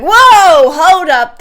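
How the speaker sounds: loud, high startled outcry; a sudden loud whoa, hold it; shocked whoa. Retold or imitated. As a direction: pull up short in alarm. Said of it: "And I was like".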